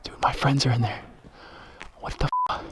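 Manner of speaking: hushed, frightened whisper; scared whisper. Real, not acted. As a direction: whisper it in fear. Hiding near shooter.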